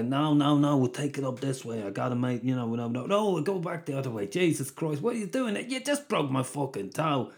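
put-on a light Irish accent for bickering junkies; lightly put-on Irish accent, arguing over a barrel; Irish smackhead accent. Junkies arguing over stolen beer, lightly put-on Irish accent. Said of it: "the best comedy smackhead accent is, is probably Irish".

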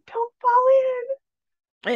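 high, pleading mom warning; worried mom. Retold or imitated. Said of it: "My mom ... from her viewpoint on the phone".

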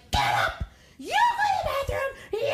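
shouted bossy mom; mock angry yell. Mom angry cleaning retold.

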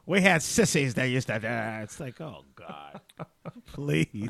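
back in my day rant. Pretend. Old lady back in my day.

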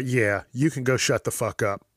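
normal talking voice

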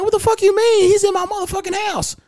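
high-pitched indignant protest; squawked disbelief, retelling an argument; retold indignation. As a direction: replay your outraged comeback, voice shooting way up. Acts out own protest to officer.

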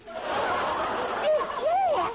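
shocked. Acted. Wife hears baby in jail.

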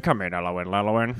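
low, muffled radio call; ham radio voice. Acted. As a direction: talk like over a CB radio. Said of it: "you dropped your ham radio voice".

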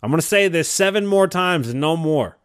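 ordinary talk, nothing marked

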